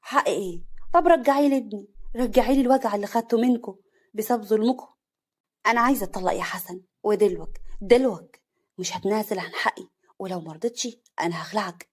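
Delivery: loud, cutting retort; harsh. Acted. Wife rejecting mother-in-law's apology, harsh and loud. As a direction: throw it back at her coldly. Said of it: "عفاف بقسوه".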